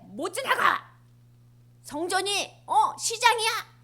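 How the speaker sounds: loud, high blocking shout; angry shout. Acted. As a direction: forbid them to pass, furious. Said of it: "성전에 가서 화내신다".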